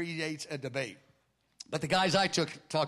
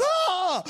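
sudden high cry of shock; a short high yelp, overwhelmed; startled cry; 7 dB louder than usual. Retold or imitated. Man struck by healing word.

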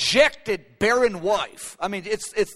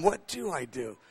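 quiet, much softer to himself; soft musing; 5 semitones lower, 8 dB quieter than usual. Retold or imitated. Pastor musing to himself in the van, quietly. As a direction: mull it over under his breath. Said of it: "haven't been asked that question in a long time".

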